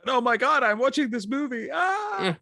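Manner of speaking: high, loud shriek of horror; horrified old lady. Pretend. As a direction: scream as the granny watching the movie.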